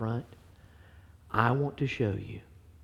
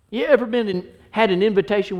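higher, louder call to the church; raised preaching; 12 semitones higher, 9 dB louder than usual. Acted. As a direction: lift it up and push it out.

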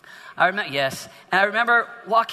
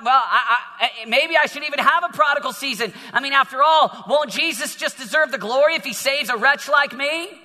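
higher, louder preaching voice; 5 semitones higher, 4 dB louder than usual. Acted. Pastor exhorting the youth with rising fervor. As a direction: press the message home to the teens.

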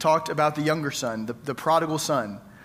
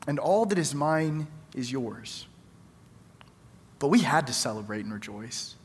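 tender father; 4 semitones lower, 4 dB quieter than usual. Acted. Father reassures older son in reading.

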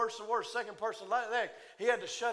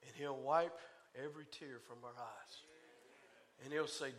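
much softer and lower, gentle and hushed; soft tender preaching; 5 semitones lower, 7 dB quieter than usual. Acted. Preacher comforting the church about heaven, hushed and tender. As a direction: paint heaven gently for the grieving.

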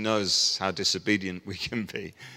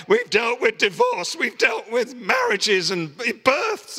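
much higher, louder mock outrage; indignant pastors; 12 semitones higher, 4 dB louder, slower than usual. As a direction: whine like overworked colleagues. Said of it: "pastors gonna be like".

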